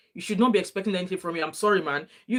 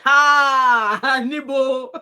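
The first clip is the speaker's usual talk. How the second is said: loud high shocked exclamation; shocked shout; 7 semitones higher, 8 dB louder, much slower than usual. Retold or imitated. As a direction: blurt it out in shock. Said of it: "Daddy Freeze opened his mouth like".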